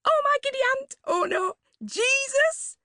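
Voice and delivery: very high, gushing mock-cheer; gaily. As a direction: squeal it out gaily. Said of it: "she said gaily".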